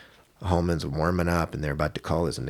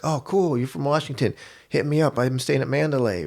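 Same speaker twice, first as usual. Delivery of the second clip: friendly reply; 8 semitones higher than usual. Retold or imitated. Voices fighter's friendly reply from window.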